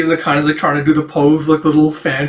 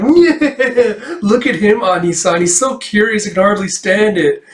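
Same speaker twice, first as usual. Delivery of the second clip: teasing through laughter, much higher; laughing; 7 semitones higher than usual. Acted. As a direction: giggle while mocking the curious kid.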